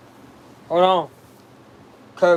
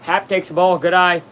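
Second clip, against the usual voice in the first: usual speaking voice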